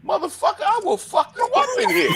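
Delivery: put-on high, heated threat to the kid; retold rage, half laughing; mock furious threat. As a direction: snap back like you're about to fight. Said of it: "I'm like".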